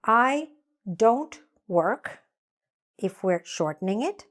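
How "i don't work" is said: slow, deliberate model sentence; slow clear model. Shown as a demonstration. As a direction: say it slowly for students to copy. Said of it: "what we would say is".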